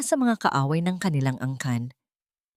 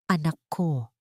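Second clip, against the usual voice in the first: a lightly voiced old father, hoarse and trembling with longing; hint of an aching, hoarse old man's voice; 3 dB quieter than usual. A narrator's reading. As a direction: softly call out to a long-lost daughter, voice shaking. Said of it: "Ang kanyang nanginginig na tinig ay namamaos, ngunit puno ng matinding pangungulila".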